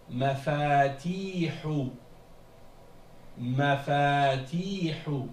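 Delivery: Mafatihu slow, with long "faa" and long "tii"; two long vowels. Shown as a demonstration. Teacher models the next word, Mafatihu with two long vowels. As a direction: stretch both middle vowels of Mafatihu. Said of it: "Il y a deux longues voyelles dans ce mot-là".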